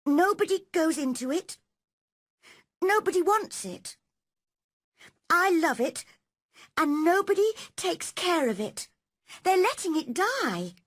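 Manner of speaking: put-on a lonely girl begging someone to understand; young girl Mary, pleading. Mary confides her secret garden to Dickon, girlish and pleading.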